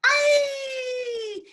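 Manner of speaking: high, loud comic wail; exasperated cry. Acted. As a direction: burst out at the noise.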